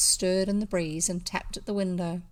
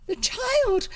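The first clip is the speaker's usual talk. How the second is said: much higher, louder alarmed shriek; 14 semitones higher, 4 dB louder than usual. A narrator's reading. The maids shriek for the stolen child, high. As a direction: shriek in panic. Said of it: "shrieked the maids".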